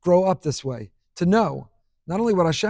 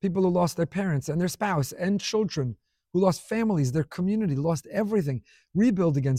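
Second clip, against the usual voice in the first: normal talking voice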